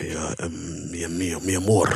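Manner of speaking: low, soft mock Spanish lover; Spanish accent. Comic attempting accent on co-host's request, low soft Spanish. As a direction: try a hushed, romantic Spanish accent. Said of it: "Do Spanish accent".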